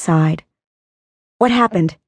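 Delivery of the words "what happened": snapping. Acted. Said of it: "he snapped at Tristan".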